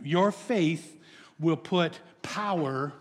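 ordinary delivery

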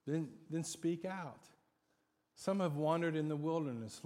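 ordinary talk, nothing marked

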